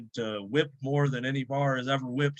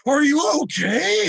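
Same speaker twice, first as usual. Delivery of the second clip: high, loud, slow worried question; mock alarmed concern; 12 semitones higher, 8 dB louder than usual. Lifter imitating manager shocked by the video, mock alarm.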